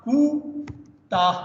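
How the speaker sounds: कूताह drawn out slowly, each vowel long; slow demo pronunciation. Shown as a demonstration. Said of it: "मिसाल के तौर खुद कूताह में". (कूताह को धीरे-धीरे खींचकर बोलना)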